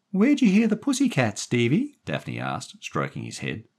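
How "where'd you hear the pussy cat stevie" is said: high, raised woman's voice; coaxing woman. A narrator's reading. Daphne asking the crying boy about the cat, coaxing. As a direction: coax him in a high voice.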